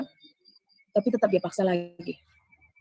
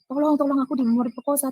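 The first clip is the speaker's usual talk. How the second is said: imagined cry for help; 6 semitones higher than usual. Retold or imitated. Imagining screaming for help from an attacker, mild mock yell. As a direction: act out a call for help at storytelling volume. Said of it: "kalau saya nanti teriak".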